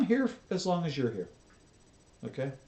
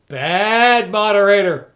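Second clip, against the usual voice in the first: mock scold; 5 semitones higher, 11 dB louder, slower than usual.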